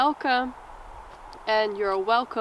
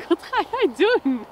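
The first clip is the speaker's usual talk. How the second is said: laughing squeal; 8 semitones higher, 4 dB louder than usual. Real, not acted. Distracted, laughing at someone's hand.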